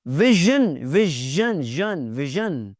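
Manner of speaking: "विजन" as vi-zhun, buzzing the middle; drawn-out zh. Shown as a demonstration. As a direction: lean on the zh in "विजन", then repeat the last syllable. Teacher models the zh sound.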